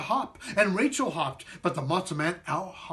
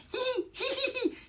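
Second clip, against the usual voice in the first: very high bashful giggle; embarrassed squeaky giggle; 4 dB quieter than usual. Acted. Matzo man blushing before the children, high embarrassed giggle. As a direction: giggle in a tiny voice. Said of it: "Then he blushed".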